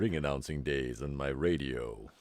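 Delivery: low deep radio voice. Pretend. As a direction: drop to a low octave. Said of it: "that is a low octave".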